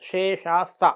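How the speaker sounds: "శేష స", an odd-timbred sibilant demo; name the "శేష స" letter distinctly; sha sound. Shown as a demonstration. The teacher sets the "శేష స" against the dental sa. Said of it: "రాసేటువంటి సా వేరు".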